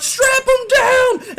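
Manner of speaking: very high, loud, stretched yell; shouted order. Acted. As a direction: bellow the order to the crew. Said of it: "They're like".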